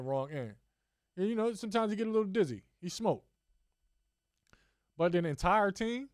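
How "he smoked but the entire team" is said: ordinary talk, nothing marked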